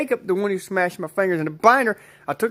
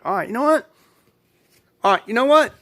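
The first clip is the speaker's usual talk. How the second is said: higher-pitched mock dare; retold defiant challenge; 6 semitones higher, faster than usual. Retelling a reply to the boy threatening to smash fingers, high challenge. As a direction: call his bluff, pitched up.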